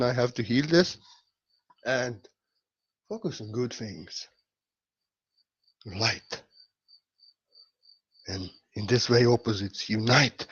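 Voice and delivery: low, measured guru teaching; deep-pitched, earnest meditative delivery; calm spiritual guidance. Real, not acted. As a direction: guide listeners deliberately, with pauses. Calm spiritual guidance after comedy.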